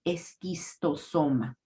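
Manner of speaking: "esquistosoma" slow, with a hard K, es-kis-to-SO-ma; "esquistosoma" drawn out, starting with "es"; K sound for CH. Shown as a demonstration. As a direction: sound out "esquistosoma" slowly, K in the second syllable. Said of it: "usar el sonido de la K".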